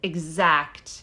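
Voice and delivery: falsely sincere emphasis; sarcastic. Real, not acted. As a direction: drop it low for dry sarcasm. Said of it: "can you tell how sarcastic I'm being".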